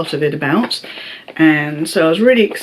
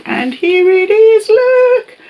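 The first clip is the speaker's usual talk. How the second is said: excited; 5 dB louder, slower than usual. Real, not acted. Showing off the arrived prize.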